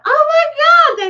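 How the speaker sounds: high, loud, slow shriek; shrieking laugh. Retold or imitated. Cracking up at her own video, shrieking. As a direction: squeal it out in delight. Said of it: "I'm screaming laughing".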